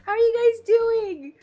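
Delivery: very high, loud, thrilled greeting; real high-pitched burst of delight; excited squeal. Real, not acted. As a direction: squeal your thanks to arriving guests. Streamer greeting a surprise raid.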